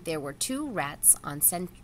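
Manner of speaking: plain reading voice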